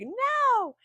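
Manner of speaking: high, loud, drawn-out cry of protest; losing it. Retold or imitated. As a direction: act out your own meltdown. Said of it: "I just lost it".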